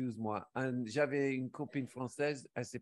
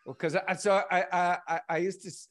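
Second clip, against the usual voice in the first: ordinary delivery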